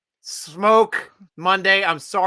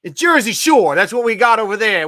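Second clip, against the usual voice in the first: put-on loud Jersey Shore accent, pitched up; 5 semitones higher, 5 dB louder than usual. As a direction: ham up a Jersey Shore guy. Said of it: "is that your Jersey accent?".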